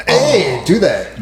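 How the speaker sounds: normal talking voice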